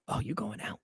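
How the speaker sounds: breathless whisper of a question. Retold or imitated. Girlfriend asking as he leaves, in a whisper. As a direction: whisper it, already annoyed. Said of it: "my girl at the time was like".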